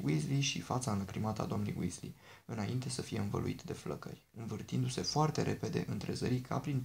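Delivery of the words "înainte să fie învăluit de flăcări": ordinary delivery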